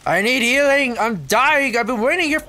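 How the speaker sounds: high, loud cry for healing; escalating play-panic yell; mock-desperate plea. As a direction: plead urgently as if dying, hammed up. Urgent plea for healing in game.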